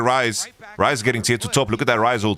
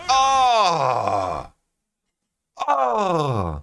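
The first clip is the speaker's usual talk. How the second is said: anguished cry; 2 semitones higher than usual. Real, not acted. Fan cries out at the loss.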